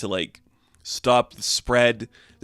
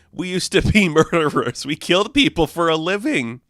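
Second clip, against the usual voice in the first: laughing; 6 semitones higher, 4 dB louder than usual. Retold or imitated. Mark joking about their past.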